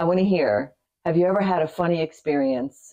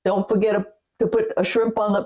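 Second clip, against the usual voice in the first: bad Australian accent; 4 semitones higher than usual. Shown as a demonstration. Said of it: "oh my God, it's so bad".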